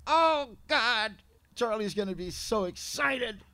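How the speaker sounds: wife greets a struggling friend at the door, thrilled; gushing excited wife. Retold or imitated. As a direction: bubble over with joy at seeing him. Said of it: "she just goes".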